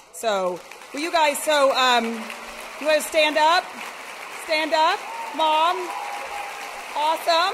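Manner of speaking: loud, high call to the crowd. Real, not acted. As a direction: call out to the audience to stand. Calling guests to stand up.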